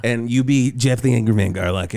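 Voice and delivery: ordinary talk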